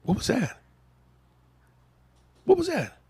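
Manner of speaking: high, alarmed questions; startled. Real, not acted. Hosts startled by a voice from a phone, high and alarmed. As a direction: react to a strange voice in the room.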